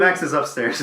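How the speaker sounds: a hint of the dad tossing off a vague answer; mock dad. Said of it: "it's, like, so vague".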